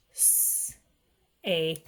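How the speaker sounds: "s, a" as a long hiss, then a separate "ay"; stretched-out sounds. Shown as a demonstration. Teacher showing parents how to stretch a word, sounded out. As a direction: sound out "s, a" slowly, piece by piece. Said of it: "stretch out that word".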